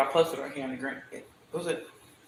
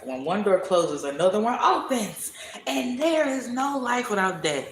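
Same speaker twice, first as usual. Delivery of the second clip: laughing proclamation; 5 semitones higher than usual. Real, not acted.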